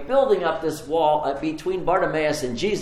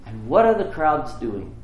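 ordinary talk

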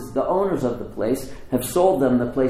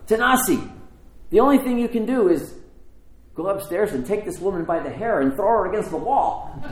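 raised, emphatic urging with a laugh at the end; urging advice; 6 semitones higher than usual. Retold or imitated. Construction workers telling the owner what to do, urging. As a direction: press him with an outrageous suggestion. Workers urge him to act.

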